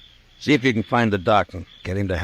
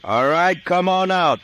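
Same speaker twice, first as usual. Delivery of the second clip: loud, higher commanding call; 11 semitones higher, 4 dB louder than usual. Acted. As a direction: call him out from a distance. Marshal calls surrendering man out.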